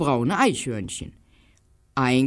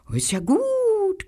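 higher, slow grumbling dog voice; higher slow grumble of a little dog; growling little dog; slower than usual. Acted. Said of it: "knurrte der kleine gelbe Hund".

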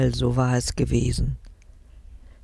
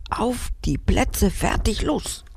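countdown; 6 semitones higher than usual. Acted. Girl counts down to run.

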